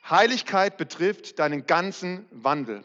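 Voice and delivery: slow read with ganzen weighted and lifted; stress on ganzen. Shown as a demonstration. Preacher reading the verse to the church, stress on ganzen. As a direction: lean into ganzen, the whole of it. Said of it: "Betonung auf ganzen".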